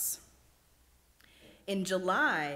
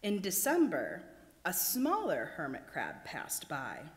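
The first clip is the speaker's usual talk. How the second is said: ordinary delivery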